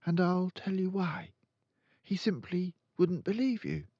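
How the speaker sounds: put-on a calm, certain young girl; Matilda's voice. Matilda correcting her friend, in a high girl's voice.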